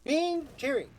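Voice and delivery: John Cena. Retold or imitated. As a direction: echo a celebrity catchphrase in imitation. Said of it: "as John Cena would like to say".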